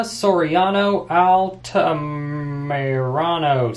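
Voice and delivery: slow, drawn-out reading of a surname; sounding it out. Real, not acted. Reading a commenter's surname, sounding it out slowly. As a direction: stretch the syllables as you work them out. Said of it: "Sound it out, [name]".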